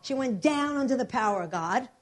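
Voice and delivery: ordinary talk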